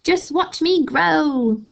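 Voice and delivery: high, slow gloating crone voice; screechy old grandma. A narrator's reading. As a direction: stretch it out, showing off. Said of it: "she shouted".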